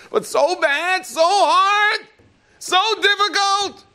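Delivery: high, loud, drawn-out whine; whining complaint. Pretend. Person complains to Hashem about hardship, whining.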